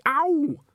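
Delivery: sharp high yelp; yelp of pain. Acted. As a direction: cry out ow. Kicked again, yelps.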